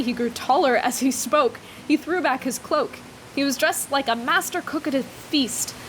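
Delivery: usual speaking voice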